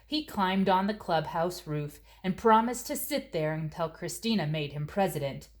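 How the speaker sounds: usual speaking voice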